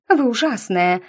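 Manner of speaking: high, indignant accusation; cried out. A narrator's reading. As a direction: blurt it out, offended. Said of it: "выкрикнула она".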